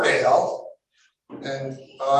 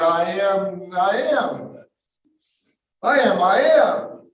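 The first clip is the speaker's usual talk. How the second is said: building proclamation; 4 semitones higher than usual. Acted. Jesus declaring his name to the crowd, building. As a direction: let it swell into a declaration.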